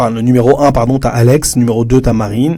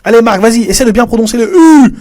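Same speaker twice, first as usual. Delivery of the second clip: much higher and louder, upbeat push; encouraging teacher; 11 semitones higher, 5 dB louder than usual. Retold or imitated. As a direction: cheer Marc on to try the U. Teacher encouraging the weak student. (professeure qui encourage Marc, voix haute et forte)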